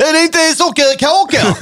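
Adde Malmberg impression. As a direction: play it baffled and high. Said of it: "våran imitation".

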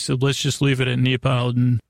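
ordinary talk, nothing marked

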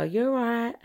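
lifted, offhand Essex greeting; casual greeting. Shown as a demonstration. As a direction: toss it out as a casual hello. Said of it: "Whereas we just go".

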